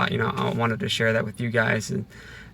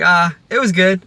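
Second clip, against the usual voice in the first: a kid's mock-bored brush-off, a touch louder and higher; bored kid answer; 6 semitones higher, 7 dB louder than usual. Imitating a kid brushing off a parent, mock bored. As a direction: toss it off like a kid who can't be bothered. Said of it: "It's like".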